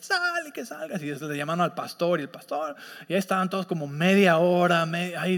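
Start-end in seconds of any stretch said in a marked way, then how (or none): 0.0-1.0 high, insistent order that falls away; mock exorcist command. Leader orders the demon out, high insistent command. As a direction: demand the demon leave, high and pressing.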